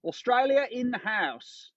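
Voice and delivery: high-pitched, hammed-up Australian; bad Australian accent. Shown as a demonstration. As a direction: badly fake an Aussie accent, drawn out at the end. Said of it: "I'm gonna do an Australian accent".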